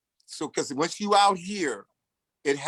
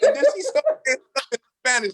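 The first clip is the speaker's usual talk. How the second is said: laughing aside; 9 semitones higher, 5 dB louder, much faster than usual. Real, not acted.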